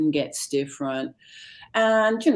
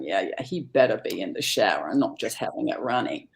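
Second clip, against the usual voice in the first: low suspicious mutter; 8 semitones lower than usual. Retold or imitated.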